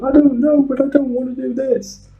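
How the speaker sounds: high mock Chinese accent, whining; quoting the tipsy nurse, high, Chinese accent. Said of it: "with a very hard Chinese accent".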